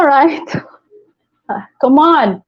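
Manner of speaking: ordinary delivery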